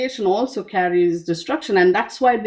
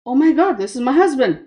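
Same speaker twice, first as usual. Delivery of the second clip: high, loud startled gasp of recognition; shocked realization; 5 semitones higher, 6 dB louder, faster than usual. Retold or imitated. Kali sees she stepped on her husband, shocked. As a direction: Kali jolts as she sees who is under her foot. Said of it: "she's like".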